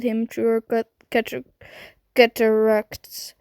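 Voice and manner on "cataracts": usual speaking voice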